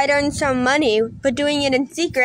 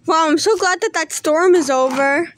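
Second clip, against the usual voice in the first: ordinary talk, nothing marked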